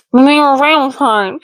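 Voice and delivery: imitating talk through a mouthful; mouth full. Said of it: "She's got food in her mouth".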